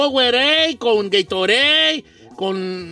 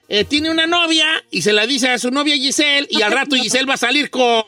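usual speaking voice

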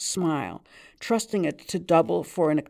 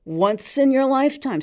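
raised, louder barman insisting; emphatic barman; 9 semitones higher, 7 dB louder than usual. Acted. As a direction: push it up as a firm point.

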